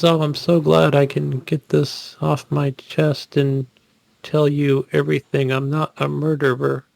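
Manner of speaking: slow, droning one-note quote; mock monotone. Mocking the wife's lines to friends, slow monotone drone. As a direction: drag it out with no emotion, mocking. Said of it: "everything is one note and nothing".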